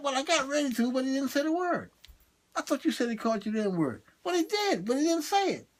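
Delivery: high-pitched acted bickering; defensive protest. Explaining to a friend why he didn't punch, defensive.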